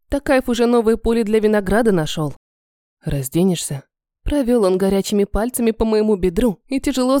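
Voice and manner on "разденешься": low male voice, coaxing; мужской голос. Acted. Эмин спрашивает, мужской голос. (низкий мужской голос, уговаривая)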